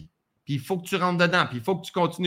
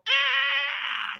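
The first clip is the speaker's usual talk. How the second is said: breathy unvoiced "ah" of a seed pushing out; seed-cracking grunt; 5 semitones higher, 3 dB quieter than usual. Coach miming a seed cracking open for the class, a strained breathy "ah". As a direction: push out a strained "ah" like a sprouting seed. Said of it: "ça, c'est mon imitation de graine".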